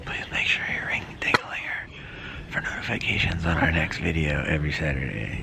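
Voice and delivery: low hushed whisper. Real, not acted. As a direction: whisper it like in church. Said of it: "Why are we whispering?".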